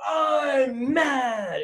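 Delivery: imitating a mad toddler's loud, high wail; long drawn-out, hammed-up tantrum shout; angry toddler. Dad mimics his two-year-old son being refused a waffle, angry toddler shout. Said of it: "So he has anger issues".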